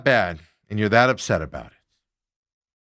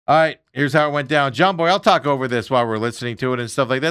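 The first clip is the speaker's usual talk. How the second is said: ordinary talk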